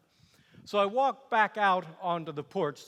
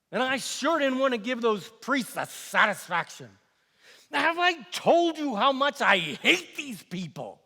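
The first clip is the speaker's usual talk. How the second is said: higher, heated venting; exasperated loathing; 7 semitones higher, 4 dB louder than usual. Acted. Governor venting about the priests he hates, exasperated. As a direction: spit out the resentment, voice rising.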